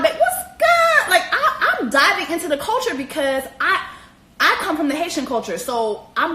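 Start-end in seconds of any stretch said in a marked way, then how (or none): 0.0-1.8 mock high squeal.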